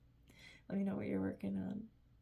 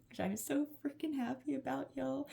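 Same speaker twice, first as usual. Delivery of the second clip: gushing, much higher; excited; 7 semitones higher than usual. Real, not acted. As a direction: squeal it with glee. Bursting with spring joy.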